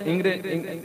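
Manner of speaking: ordinary talk, nothing marked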